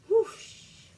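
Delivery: high, loud rushing whoosh; whoosh sound. Acted. Peter dashes off, loud high whoosh effect. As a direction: sweep the sound fast past. Rabbit racing away.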